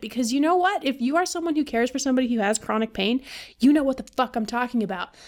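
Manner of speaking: usual speaking voice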